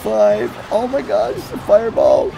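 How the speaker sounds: loud, urgent countdown to the buzzer; nail-biting live gameplay call; real rising panic at the clutch moment; tense excitement. Real, not acted. Tense countdown at end of match.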